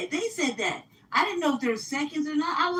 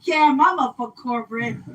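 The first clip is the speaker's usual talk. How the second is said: loud, brash imitation of a comedian's rant; Eddie Murphy, real loud; 8 dB louder than usual. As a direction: belt it out like a stand-up bit. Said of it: "I was about to be like Eddie Murphy, like, real loud".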